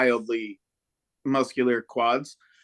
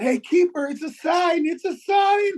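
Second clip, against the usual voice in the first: much higher, shouting, ending in a whispered cry; patriotic heckle; 5 dB louder, slower than usual. Retold or imitated. Fan heckling the English goalie as an eagle flies over, rising shout. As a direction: build from taunt to ecstatic patriotic yell. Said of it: "And I said".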